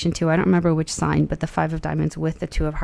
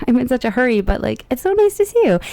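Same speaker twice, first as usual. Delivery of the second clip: higher, hurried cheerful chatter; girl in a hurry greeting a dog, higher and quick; hurried Alice; 6 semitones higher than usual. Pretend. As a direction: rush out a friendly hello on the run. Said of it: "And she's like".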